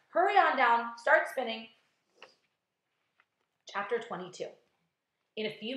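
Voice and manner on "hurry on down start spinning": urgent command. A narrator's reading. Captain urging the spider.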